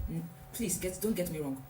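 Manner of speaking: ordinary talk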